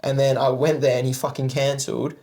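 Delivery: ordinary talk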